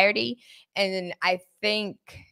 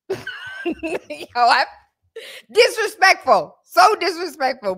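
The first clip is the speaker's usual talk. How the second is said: high laughing mock offense; high laughing cry of disrespect; laughing disbelief; 9 semitones higher, slower than usual. Real, not acted.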